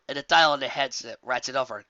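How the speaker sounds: plain reading voice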